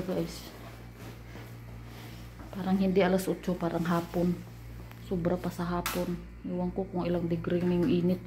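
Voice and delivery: soft indoor voice; quiet. Real, not acted. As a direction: keep it low and soft. Talking softly indoors.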